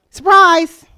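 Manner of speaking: spring the twins news like a party reveal; wry surprise. Real, not acted. Speaker telling audience about late twins, loud mock-cheerful shout.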